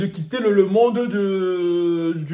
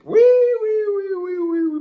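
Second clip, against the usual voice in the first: mock-gleeful squeal; 14 semitones higher, 4 dB louder, faster than usual. YouTuber relishing gossip about colleagues, squealing high. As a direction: squeal it out in comic glee.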